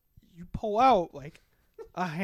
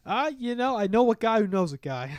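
high, loud bartender voice; lively, higher-pitched character voice; wheeler-dealer bartender; 6 semitones higher, 8 dB louder than usual. Acted. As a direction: play a bartender with connections, pitched up.

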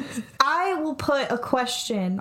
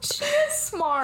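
very high, slow, drawn-out protest; mock indignant; slower than usual. Friend defending the dog in a high mock protest.

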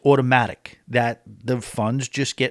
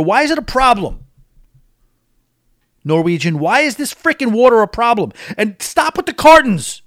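higher, louder exasperated rant; fed-up complaint, punched loud and high; exasperated outburst; 8 semitones higher, 7 dB louder than usual. Real, not acted. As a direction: raise the pitch and voice in fed-up disbelief. Exasperated rant about water policy.

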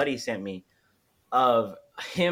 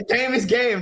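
laughing repeat; 9 semitones higher, 5 dB louder than usual. Real, not acted. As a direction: laugh through it, much higher. Laughs repeating friend's line.

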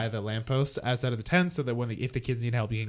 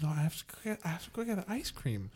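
sleepy mumble; 3 semitones higher, 11 dB quieter, faster than usual. Retold or imitated. Sleepwalking kid insists again.

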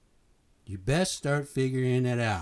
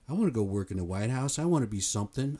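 ordinary talk, nothing marked

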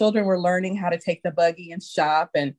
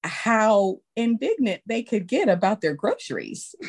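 ordinary talk, nothing marked

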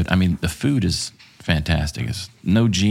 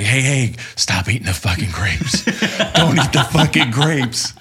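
urgent warning shout; 5 semitones higher than usual. Retold or imitated. Telling the meeting to stop eating, frantic half-laughing shout. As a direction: yell at the room to stop eating. Rushing back to warn everyone.

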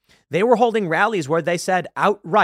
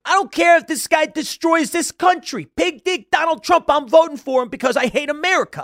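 much higher, louder mocking impression of him; imitating Michael Rapaport; 11 semitones higher, 5 dB louder than usual. Podcaster mocking Rapaport's rant with a much higher, louder voice. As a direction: ham up a whiny celebrity rant. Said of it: "I'm trying to imitate him".

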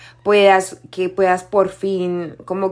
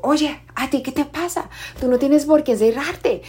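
higher-pitched indignant telling-off, retold; mock scolding in a raised pitch; angry scolding; 7 semitones higher than usual. Worker retells confronting a child who shut himself in, angry scolding. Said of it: "yo también estoy brava".

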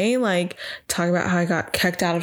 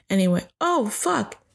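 ordinary talk, nothing marked

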